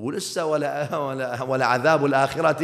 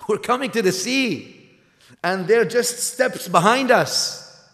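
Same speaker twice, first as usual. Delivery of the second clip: higher, louder, alarm rising on coming; alarmed repeat; 9 semitones higher, 7 dB louder, slower than usual. Acted. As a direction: repeat it as the danger sinks in. People panic at the sea.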